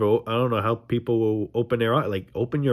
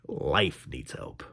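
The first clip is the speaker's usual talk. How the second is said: lower, softer, slow three words; 8 semitones lower, 8 dB quieter, much slower than usual. Real, not acted. Vlogger answering a friend, low slow and soft. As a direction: drop the voice and slow it right down.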